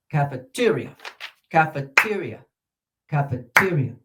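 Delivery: cafeteria with the third syllable te highest and stressed; stress demo. Shown as a demonstration. Teacher repeating cafeteria so the class hears the stress. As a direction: lift the te in cafeteria above the rest. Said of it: "listen to the highest syllable".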